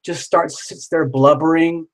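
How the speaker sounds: usual speaking voice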